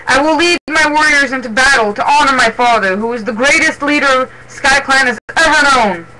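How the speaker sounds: a leader's stirring declaration; rallying proclamation. A narrator's reading. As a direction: proclaim it proudly to the warriors. Leader rallies warriors in story.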